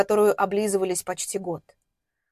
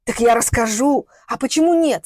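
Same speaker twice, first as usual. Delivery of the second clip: angry retort; 2 semitones higher, 5 dB louder than usual. Acted. Woman threatens to tell everything, loud defiant anger. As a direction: throw the threat back at him.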